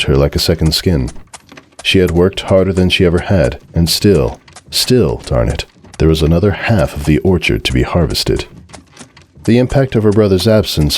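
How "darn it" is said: ordinary delivery, nothing marked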